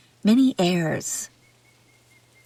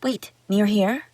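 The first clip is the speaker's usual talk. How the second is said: ordinary delivery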